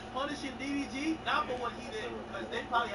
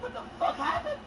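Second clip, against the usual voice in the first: shocked. Retold or imitated. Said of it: "I'm like".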